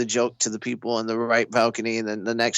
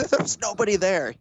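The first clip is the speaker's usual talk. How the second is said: high, laughing through the words; laughing impression of Bert; 8 semitones higher than usual. Comic retelling Bert's laughing line, high and laughing. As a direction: wheeze it out mid-laugh.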